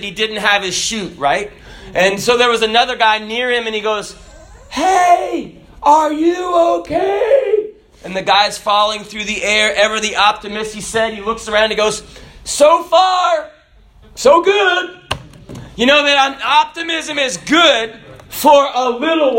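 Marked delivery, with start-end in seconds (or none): 4.7-7.7 high, slow shouted call; calling out midair. Retold or imitated. Skydiver calling to the falling man, high shout. 12.4-14.9 high, loud chipper reply; cheery optimist. Retold or imitated. Falling man answering his neighbor, cheerful shout.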